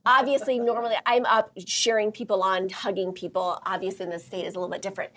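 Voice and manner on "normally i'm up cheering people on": normal talking voice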